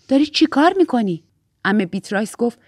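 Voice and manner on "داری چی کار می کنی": usual speaking voice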